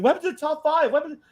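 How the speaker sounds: high-pitched imitation of asking listeners; pleading fans. Host imitating listeners asking after a dropped segment, mock pleading. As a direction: pitch it up as mock audience questions. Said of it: "people have been asking".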